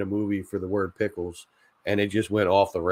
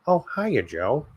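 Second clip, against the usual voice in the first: cartoon impression; 5 semitones higher, slower than usual. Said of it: "my impression of him".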